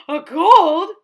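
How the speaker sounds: a gasp and a loud question; gasp, then ask it; surprised gasp. Acted. Gerald is surprised.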